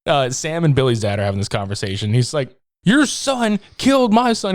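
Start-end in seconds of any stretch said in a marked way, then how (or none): 2.8-4.5 higher, slower accusing dad voice; a hint of a grieving dad accusing; mock angry father. Host voicing the dad blaming the other father, higher and slow.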